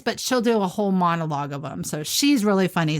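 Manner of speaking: usual speaking voice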